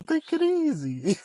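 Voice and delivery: put-on high, slow Mexican-accented take it easy, Nacho Libre style. Husband teasing his angry wife in a slow, high Mexican accent. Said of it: "with the accent".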